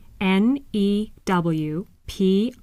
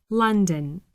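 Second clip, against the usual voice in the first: ordinary delivery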